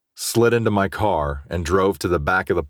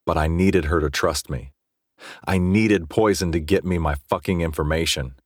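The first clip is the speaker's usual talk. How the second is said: ordinary delivery, nothing marked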